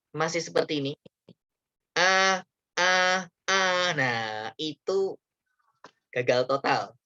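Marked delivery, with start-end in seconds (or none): 1.9-3.6 a, a forced out through the nose; nasal wrong a, a. Shown as a demonstration. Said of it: "Kalau Bapak Ibu masih seperti ini".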